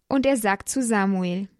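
ordinary delivery

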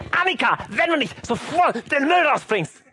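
acted high-pitched parental yell. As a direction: lose patience and threaten the child. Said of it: "dann schrei ich ganz laut". (schimpfende Mutter, laut gespielt)

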